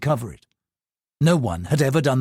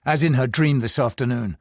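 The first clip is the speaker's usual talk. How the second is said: usual speaking voice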